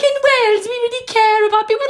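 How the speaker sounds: high, loud mock Welsh voice; effeminate Welsh accent. Imitating a debate stand-in as Leanne, effeminate Welsh accent. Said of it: "this really effeminate Welsh accent".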